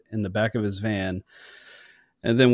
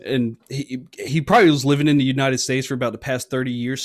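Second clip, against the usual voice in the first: usual speaking voice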